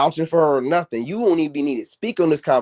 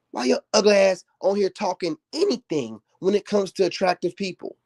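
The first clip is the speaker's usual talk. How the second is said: ordinary talk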